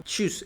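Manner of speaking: "chus" with a light t before ch; German Tschüs, lightly started on t; T ligera inicial. Shown as a demonstration. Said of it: "un sonido ligero de T y luego chus". ("chus" con una t ligera al inicio)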